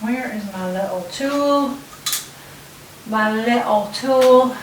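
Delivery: slow, drawn-out mock English accent; English accent, stretching the words out; bad English accent. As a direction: put on a hammy, unconvincing English accent. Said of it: "Really bad English accent, I know".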